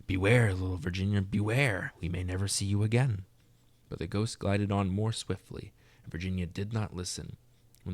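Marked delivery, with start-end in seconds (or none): none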